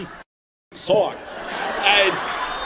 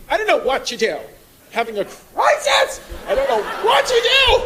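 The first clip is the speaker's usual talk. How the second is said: mock whiny girl; 11 semitones higher than usual. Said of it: "a girl the other day just complaining".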